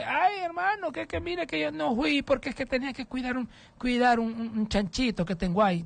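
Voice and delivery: put-on high, whiny old-woman excuse; hermana Amparo. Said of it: "la hermana Amparo de Guazucarán, que esta vieja". (viejita quejumbrosa, voz aguda)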